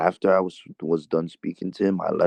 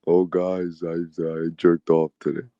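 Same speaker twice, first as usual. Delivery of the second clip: imitating Tom. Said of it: "Tom's gonna say".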